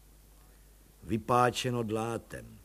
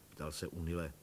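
ordinary delivery

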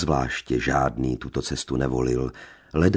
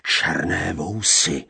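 brooding repetition; slower than usual. A narrator's reading. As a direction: turn the words over darkly. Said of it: "opakoval cikán".

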